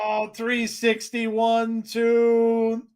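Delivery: high slow excited match call; wrestling commentator. Acted. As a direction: count the pin like a ringside announcer. Live wrestling play-by-play.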